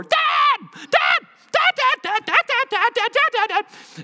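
put-on very high, loud child's calling; son calling Dad. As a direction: shout it like an excited little boy tugging at your jacket. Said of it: "it sort of sounded like that".

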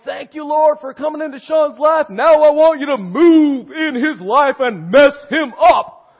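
loud, high, intense shouted prayer; pumped-up preacher. Retold or imitated. Intense pastor praying over a brand-new convert, pumped-up shout. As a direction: pray it at full force, hugely pumped. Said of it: "He's pumped, you know?".

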